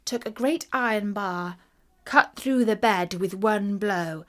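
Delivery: ordinary delivery, nothing marked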